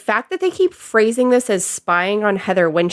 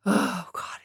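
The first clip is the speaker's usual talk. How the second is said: whispered, much softer groan; exasperated whisper; 8 dB quieter than usual. Real, not acted. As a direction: breathe it out in disbelief. Quietly seething about the unfairness.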